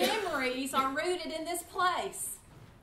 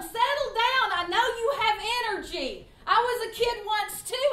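put-on raised, higher scolding call to rowdy kids; telling kids to settle; 8 semitones higher, 6 dB louder than usual. Drama director calling rowdy kids to settle down. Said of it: "And I tell them".

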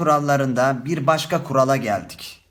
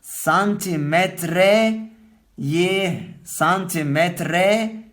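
drawn out, syllable by syllable; slow teacher's dictation of santimetreyi; slow demo; 3 semitones higher, much slower than usual. Shown as a demonstration. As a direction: spell it out slowly for the class. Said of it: "Bak, yazıyorum".

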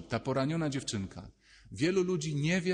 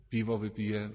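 offhand; 4 semitones lower than usual. Retold or imitated. Mock answers to sadness.

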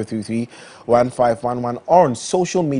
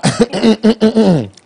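coughing; 10 semitones higher, 6 dB louder than usual. Real, not acted. Coughs before the show starts.

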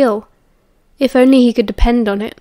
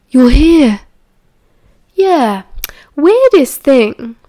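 loud, drawn-out amazement; wondering, raised voice; surprised; 3 semitones higher, 5 dB louder, much slower than usual. A narrator's reading. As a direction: exclaim it, then puzzle slowly. Sirius surprised to find him home.

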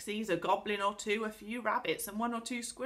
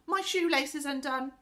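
raised, higher voice calling ahead; 8 semitones higher, 5 dB louder than usual. A narrator's reading. Girl making an excuse to the others, calling out. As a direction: call to friends a little way off. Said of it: "she called to the others".

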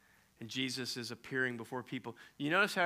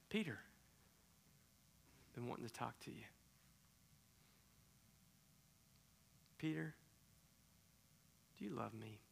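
soft, near-whispered tender questions; hushed tender exchange; 18 dB quieter than usual. Retold or imitated. Jesus quietly asking Peter about love, soft and tender. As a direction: hush the room, ask gently.

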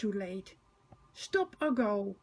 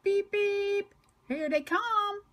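shouting Thomas; 10 semitones higher, slower than usual. A narrator's reading. Thomas shouting to the arriving children. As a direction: call out high and bright as Thomas. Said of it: "shouts Thomas".

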